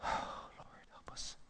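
sighing, hushed plea; whispered prayer. Real, not acted. Preacher sighs and whispers a prayer to the Lord. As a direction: breathe a quiet prayer after a sigh.

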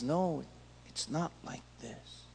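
soft, whispered no; quiet firm reply. Acted. God quietly correcting Joshua, hushed whisper. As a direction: answer gently, hushed and certain.

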